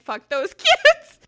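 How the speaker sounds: high, loud, slow mocking line breaking into laughter; laughing imitation. Mom re-quoting daughter: laughing imitation. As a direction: redo the fierce line but crack up midway. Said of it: "she meant it".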